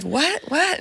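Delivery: high, rising disbelief; startled father imitated. Dad told his daughter is missing, retold shock.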